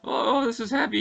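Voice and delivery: high, bright-eyed catchphrase delivery; Michael J. Fox impression. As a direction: squeak it out like a startled teen hero. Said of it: "that's how he talks".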